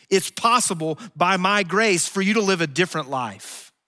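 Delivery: loud, high, driving preacher's voice; preaching at full force. Real, not acted. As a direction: preach it out to the whole room. Preacher raising voice on grace.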